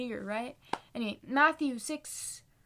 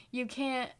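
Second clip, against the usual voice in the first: ordinary talk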